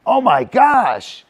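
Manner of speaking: high, loud, slow gasp of surprise; a sudden startled cry; surprised shout. Real, not acted. Trainer spots something while watching dog, surprised shout.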